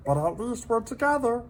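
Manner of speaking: high little creature voice, gently pitying; a tiny pet breaking hard news kindly; sympathetic salamander voice. A narrator's reading. Minton consoling lonely Hector, high sympathetic voice. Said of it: "he said".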